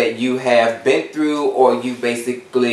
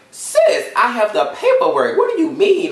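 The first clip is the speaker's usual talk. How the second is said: very high-pitched, incredulous protest; retold exasperation; 10 semitones higher than usual. Student insisting to the lady she has proof, exasperated. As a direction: squeak it out in exasperated disbelief.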